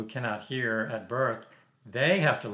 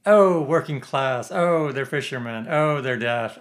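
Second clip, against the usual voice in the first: loud, drawn-out mock pity on each "Oh"; mock patronizing; 3 semitones higher, 8 dB louder, slower than usual. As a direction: gush condescendingly, hitting every "Oh" hard. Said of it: "it's so patronizing".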